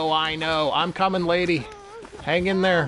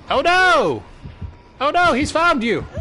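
high, loud cry of alarm; panicked game shout; 9 semitones higher, 4 dB louder than usual. Real, not acted. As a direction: yell out the warning. Teammate caught by killer.

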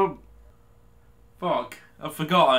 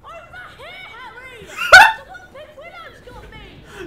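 loud, very high laughing fit; long high-pitched cackling; hysterical laughter; 6 dB louder than usual. Real, not acted. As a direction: laugh helplessly in high squeals. Hysterics at game shouting.

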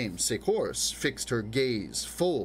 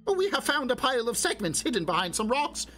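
higher, eager announcing; breathless discovery, a touch raised; lightly voiced excited sister; 13 semitones higher than usual. Sister announces the hidden segments to Dame Secourse, eagerly.

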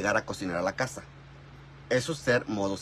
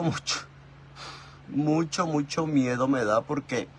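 ordinary talk

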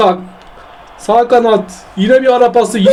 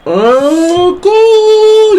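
excited goal shout; 9 semitones higher than usual. Real, not acted. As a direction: yell the goal out high. Goal scored in the game. (heyecanlı gol çığlığı)

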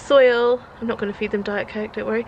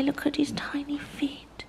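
slow, hushed whisper of wonder; 4 semitones higher, 8 dB quieter than usual. Real, not acted. Cooing over the newborn's tiny feet, whispered.

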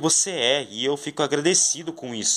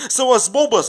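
high, loud warning cry; panicked warning; alarm shout; 10 semitones higher, 5 dB louder than usual. Pretend. Bombs arriving.

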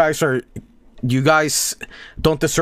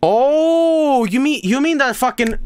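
mock excitement; 8 semitones higher than usual.

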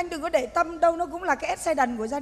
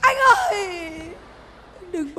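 mock wail; 3 dB louder, slower than usual. Woman wailing after her man, laughing sob. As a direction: cry out after him, hammed-up.

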